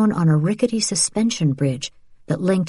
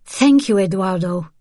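grateful; 3 semitones higher than usual. Acted. Isabel's grateful thanks.

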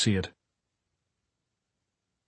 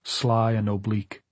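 plain reading voice

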